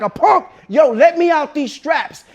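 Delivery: indignant demand. Retold or imitated. Patient to dentist, wanting out of the straps, indignant. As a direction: act out an angry demand to be freed.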